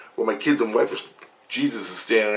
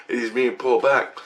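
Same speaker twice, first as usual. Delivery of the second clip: normal talking voice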